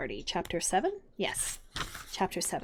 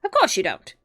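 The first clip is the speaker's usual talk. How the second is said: loud scornful Hatter; contemptuous; 8 dB louder than usual. A narrator's reading. Hatter scorning Alice, loud and contemptuous. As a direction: toss it off with contempt. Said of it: "tossing his head contemptuously".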